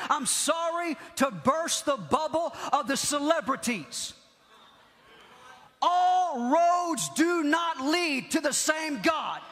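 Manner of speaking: forceful proclamation. Acted. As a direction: hammer it out word by word. Preacher proclaiming, slow and shouted.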